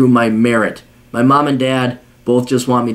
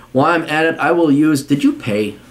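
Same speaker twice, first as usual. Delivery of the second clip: ordinary talk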